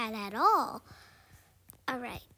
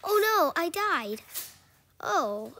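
ordinary delivery

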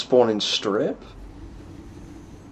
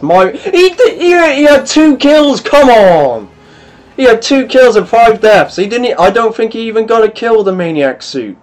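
indignant rant; 7 semitones higher, 6 dB louder than usual. Real, not acted. Ranting about enemy player.

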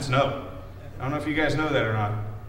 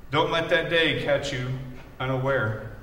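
ordinary talk

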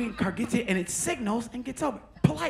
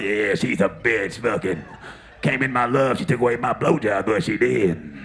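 lower, slow, drawled Florida lady; Florida woman impression; 10 semitones lower, 6 dB louder than usual. Comic mimics the heckler, lower and slow. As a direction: talk like a bitter Florida local. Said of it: "that's the part of Florida".

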